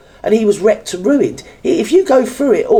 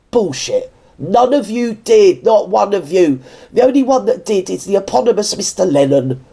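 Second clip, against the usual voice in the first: normal talking voice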